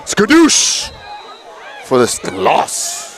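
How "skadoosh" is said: playful shout. Pretend. Playful catchphrase after tackle.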